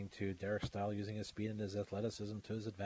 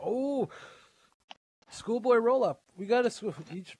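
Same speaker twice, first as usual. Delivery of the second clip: excited commentary; 10 semitones higher, 8 dB louder than usual. Acted. Excited play-by-play near fall.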